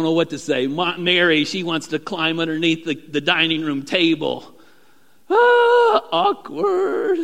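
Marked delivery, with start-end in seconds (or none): 5.3-7.2 high, loud cringing ah; cringing awkward. Acted. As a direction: squirm out the word awkward. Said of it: "Talk about an awkward moment".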